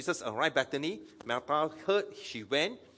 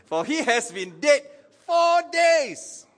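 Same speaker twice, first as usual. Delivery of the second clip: high, loud exclamation building to a shout; exclaimed objection; 12 semitones higher, 12 dB louder, slower than usual. Acted. Martha objecting at her brother's tomb, rising to a shout.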